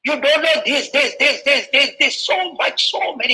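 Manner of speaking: an upset schoolboy venting at the president, pitched high; schoolboy complaining to the president in a high, agitated mock outburst; retold angry outburst. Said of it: "He was irritated".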